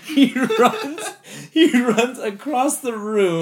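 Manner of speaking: high, loud, slow through laughter; laughing. Real, not acted. Friend retelling David fleeing, laughing hard. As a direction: squeal it while cracking up.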